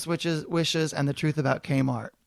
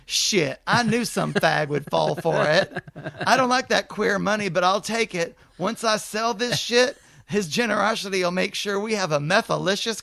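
hillbilly voice; 2 semitones higher than usual. Acted. Travis from Oklahoma talking to the manager, mock Okie accent. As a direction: play the rough Okie dad, sneering.

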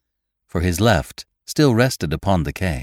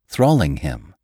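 usual speaking voice

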